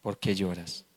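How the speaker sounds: low, hushed question; husband questioning Ana. Acted. Husband asking Ana why she cries, low and soft. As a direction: ask it softly, low in pitch. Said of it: "le dijo a Ana".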